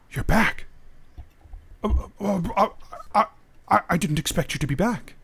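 stammering, caught off guard; startled stammer. Acted. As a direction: stumble over the words in mild surprise. Startled clansman greets returning woman.

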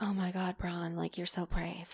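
soft, breathy-free gushing admiration in a hushed mock voice; mock swooning. Party guests gushing at the bragging jock, mock swooning softly. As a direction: coo it like a starstruck fan.

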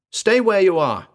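put-on high, loud girl's order; commanding. A narrator's reading. Jungle girl to trapped man, commanding. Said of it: "the girl commanded".

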